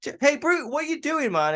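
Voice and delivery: high-pitched imitation of a friend calling out; high mock voice. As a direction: voice jumps way up, teasing. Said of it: "and he's like".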